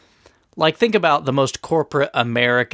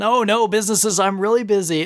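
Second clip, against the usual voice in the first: higher, airy brush-off about work; breezy excuse; 8 semitones higher than usual. Retold or imitated. Paul tells his wife he's busy with work, light and airy. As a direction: wave it off like a busy dad.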